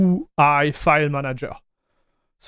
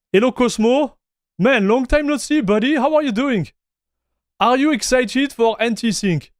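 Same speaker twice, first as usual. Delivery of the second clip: excited greeting; 6 semitones higher than usual. Real, not acted. Greeting a returning viewer.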